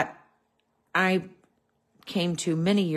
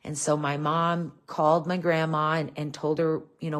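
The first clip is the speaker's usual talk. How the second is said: normal talking voice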